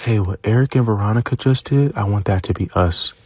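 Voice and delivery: low soft mock whisper in the ear; retold whisper. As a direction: hush it like a secret come-on, half-played. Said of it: "starts whispering in my ear".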